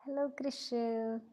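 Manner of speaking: ordinary delivery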